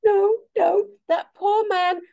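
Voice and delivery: high, loud laugh-crying through the words; real helpless laughter. Real, not acted. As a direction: cringe with laughter. Laughing at the awkward scene.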